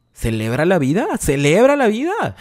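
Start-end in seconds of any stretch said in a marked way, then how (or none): 1.2-2.4 más agudo y más fuerte, entusiasmo de burla; ánimo exagerado. Retold or imitated. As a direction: como quien anima con falsa alegría. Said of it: "Y yo te digo". (falsa alegría, aguda y fuerte)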